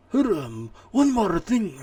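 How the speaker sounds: put-on second try at Japanese accent. Streamer retrying the Kojima line in a hammed-up Japanese accent. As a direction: ham up the Japanese accent on the retry and jump way up in pitch. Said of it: "I can't do a Japanese accent".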